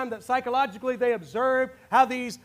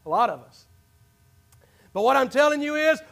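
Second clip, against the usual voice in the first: normal talking voice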